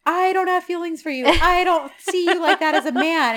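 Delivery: high-pitched mock declaration; hammed-up rejection. Woman rejecting man in retold drama, hammed-up.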